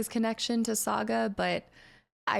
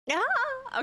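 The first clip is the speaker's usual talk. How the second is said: startled yelp. Real, not acted. Startled while playing.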